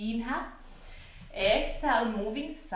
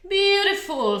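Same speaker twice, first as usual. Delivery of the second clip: excited praise; 10 semitones higher, 8 dB louder than usual. Real, not acted. Yoga teacher praising the pose.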